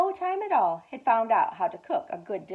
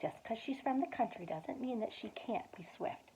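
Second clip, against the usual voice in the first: whispered aside; 10 dB quieter than usual. Real, not acted. Parent whispering a side comment to the child, hushed. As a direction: hush your voice to share a secret.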